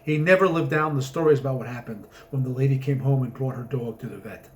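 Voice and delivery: plain reading voice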